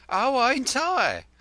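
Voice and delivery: high, loud, slow snap; wife snapping back at her husband; flashing retort. A narrator's reading. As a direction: fire it straight back. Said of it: "flashed his spouse".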